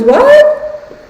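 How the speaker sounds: high astonished squeal of what; high incredulous what; shocked what. Retold or imitated. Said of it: "she goes".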